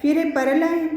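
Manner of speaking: ordinary delivery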